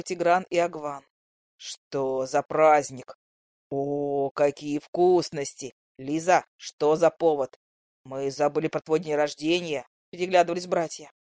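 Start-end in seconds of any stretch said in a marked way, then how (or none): 1.6-9.9 lightly lowered cheerful brother; easy teasing young man; brothers' voice. A narrator's reading.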